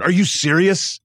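high, eager are-you-serious; excited disbelief. Retold or imitated. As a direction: pitch it up like you can't believe your luck. Excited reporter begging for interview.

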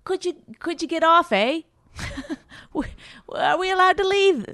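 badly faked Canadian accent that lands British, high; accidental British accent. Polite Canadian heckler asking to leave, in an accidental British accent. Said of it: "that's a British accent I did for Canada".

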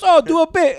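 cab driver. Retold or imitated. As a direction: beg like a thrilled fan. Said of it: "He goes".

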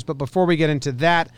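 usual speaking voice

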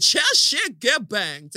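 slow drawn out British accent imitation again; mock British accent. Chair repeating the British lady, mock British accent. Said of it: "She said".

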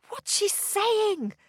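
put-on high, drawn-out boy's question; curious boy. A narrator's reading. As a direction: ask it as a puzzled young boy. Boy character asks a question.

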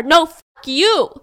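loud, high, slow retort; acted-out angry comeback; screamed back. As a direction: yell it back in her face. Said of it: "screams back at her".

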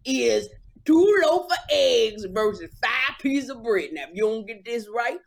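hammed-up country game-show host; Steve Harvey impression. Co-host mimics the host quizzing Family Feud contestants, Steve Harvey impression. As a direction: stretch the question out, then shout the catchphrase. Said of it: "That ain't what Steve sound like".

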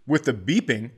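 usual speaking voice

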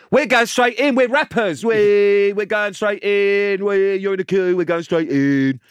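very high, loud mock bragging yell; big-deal boasting. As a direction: imitate a mate whooping past the whole queue. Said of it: "made such a big fucking deal".

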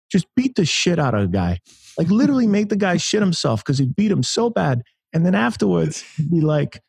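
terrible Mike Tyson. Retold or imitated. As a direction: pitch it way up, a famous heavyweight raving about a hero. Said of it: "that's my terrible Mike Tyson impression".

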